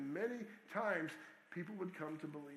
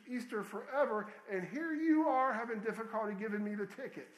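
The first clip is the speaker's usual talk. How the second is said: mock scolding, joyful; 5 semitones higher, 4 dB louder than usual. A narrator's reading. Edith scolds her doctor about dying.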